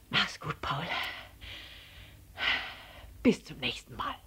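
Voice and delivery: leise und erschöpft, mit Atempausen; außer Atem Abschied nehmen; japsend. A narrator's reading. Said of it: "jappte er". (matt gehauchter Abschied)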